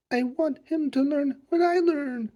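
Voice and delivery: put-on high, slow child's protest, mildly sputtered; sputtering. A narrator's reading. Charlie excusing himself to Miss Finn, mildly sputtering. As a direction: explain yourself in a high, halting voice. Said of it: "sputtered Charlie".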